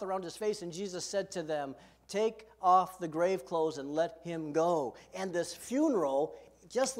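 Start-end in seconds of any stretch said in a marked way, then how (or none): 2.1-4.9 commanding call. Acted. Jesus commands at the tomb.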